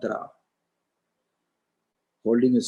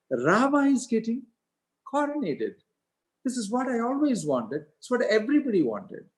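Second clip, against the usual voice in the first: much higher, delighted queen voice; joyful queen Kaikeyi; 5 semitones higher than usual. Acted. Kaikeyi rejoices over Rama's coronation, much higher delighted voice. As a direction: gush happily as the adoring queen.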